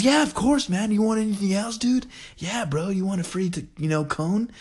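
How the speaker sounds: high, laid-back dude voice, eager to please; imitating a cool fast-food worker, high and breezy; friendly cashier. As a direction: play the chill employee who hooks you up.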